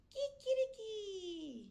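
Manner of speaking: high, slow cock-a-doodle; playful teacher rooster call; rooster crow. Shown as a demonstration.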